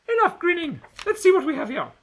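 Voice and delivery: high, loud stern order; glowering order. A narrator's reading. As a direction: snap at her to stop grinning. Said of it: "Gretchen glowers".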